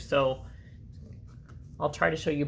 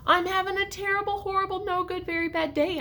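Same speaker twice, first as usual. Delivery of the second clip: put-on higher, complaining little boy; whiny kid; 13 semitones higher, 3 dB louder than usual. Alexander complains to everybody in a high whine. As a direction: whine it to the whole family. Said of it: "I told everybody".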